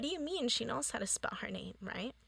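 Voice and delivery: hushed, fast, confused whisper. Retold or imitated. Sister whispering to the principal, fast and hushed. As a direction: whisper it so the teacher barely hears.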